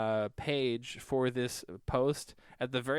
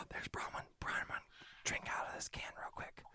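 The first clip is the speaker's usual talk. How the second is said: breathy hushed whisper; 14 dB quieter than usual. Real, not acted. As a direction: whisper it so nobody wakes. Whispering so as not to disturb.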